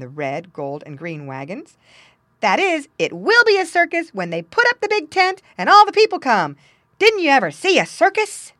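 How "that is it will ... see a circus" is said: high, loud lively monkey; excited, high-pitched monkey voice; merry monkey, amused. Acted. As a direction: chatter brightly as a circus monkey.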